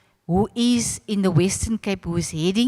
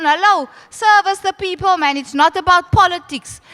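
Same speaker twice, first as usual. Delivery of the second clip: scolding; 7 semitones higher, 5 dB louder than usual. Retold or imitated. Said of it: "So I tell, uh, Avron".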